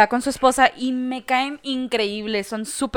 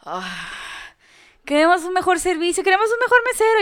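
mock demanding customer; 6 semitones higher than usual. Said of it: "siempre me ve y lo".

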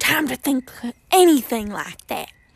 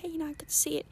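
whisper; 4 semitones higher, 12 dB quieter, faster than usual. Real, not acted. Watching tornado approach, hushed.

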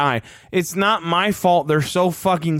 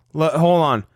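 ordinary delivery

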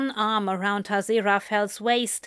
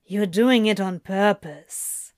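grumbling. Acted. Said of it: "Crowley grumbled".

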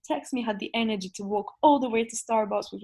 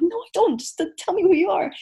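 high, louder exasperated retort; retold exasperation, pitched up and louder; 9 semitones higher, 6 dB louder than usual. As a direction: demand an answer, fed up. Retold exasperated phone quarrel.

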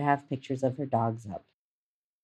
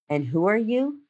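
higher, rising puzzled question; questioning aunt; 7 semitones higher than usual. A narrator's reading. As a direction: lightly voice a confused aunt querying a stranger. Crestfallen aunt questions the stranger.

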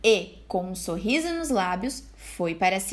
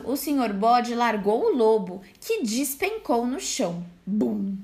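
ordinary delivery, nothing marked

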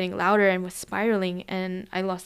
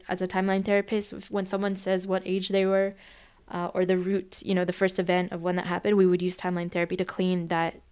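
ordinary talk, nothing marked

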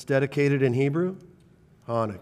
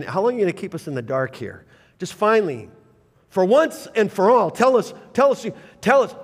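rising, louder pleading, tell us pushed high; demanding crowd; 6 semitones higher, 5 dB louder than usual. Retold or imitated. Crowd pressing Jesus for an answer, rising loud demand. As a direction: press harder with each tell us. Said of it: "they're coming to him and saying".

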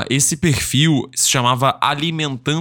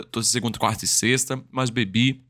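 normal talking voice

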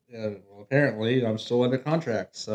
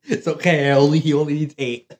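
soothing a scared dog; 3 semitones higher, 9 dB louder than usual. Retold or imitated. Guard reassuring his gun.

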